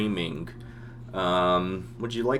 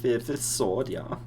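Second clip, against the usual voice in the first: GM voicing a German soldier with accent; thick Germanic accent; 5 semitones higher, slower than usual. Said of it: "like a French-German bastard".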